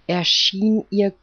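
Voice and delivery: usual speaking voice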